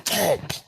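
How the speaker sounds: loud, high popped thump; imitated jolt thump. Showing how the jolt felt in the chest, imitated thump. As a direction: punch out the noise of an electric jolt. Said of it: "That's what it felt like".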